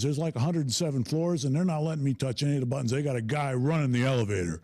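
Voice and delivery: ordinary delivery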